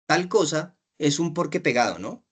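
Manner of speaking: normal talking voice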